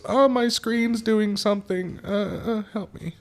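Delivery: high, slow helpless whine of a customer; high mock customer. Service writer mimics a helpless customer, high and slow. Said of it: "and she goes".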